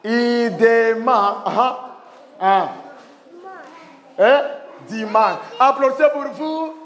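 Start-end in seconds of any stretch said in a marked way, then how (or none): 0.1-1.4 loud, very slow "Idema"; slow word model. Shown as a demonstration. Teacher sounding out an example, slow and loud. 5.6-6.7 loud, chanted call for applause; rally the whole class, loudly. Real, not acted. Teacher to class ending the examples, calling out.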